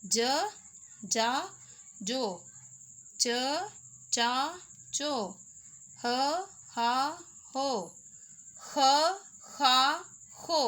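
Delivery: one syllable at a time, crisp and spaced; syllable drill. Shown as a demonstration. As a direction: recite each sound clearly for children. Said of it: "ज के ख़ानदान को पढ़ना है". (एक-एक अक्षर साफ़ बोलकर पढ़ाना)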